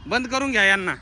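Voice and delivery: high, loud hammed-up threat to lock them in; playful mock threat; mock guard. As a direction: play the gatekeeper teasing the kids. Mock voice of gate men.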